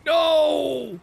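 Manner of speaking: sudden high loud no; shout. Real, not acted. Streamer yelps no at his truck mishap. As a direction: yelp it out in alarm.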